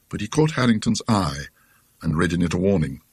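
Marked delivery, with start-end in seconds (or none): none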